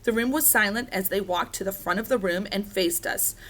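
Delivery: usual speaking voice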